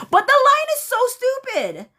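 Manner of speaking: very high-pitched outburst; incredulous exclamation. Real, not acted. Host ridiculing a cheesy film line, incredulous exclamation. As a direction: let the pitch leap up, then drop at the end.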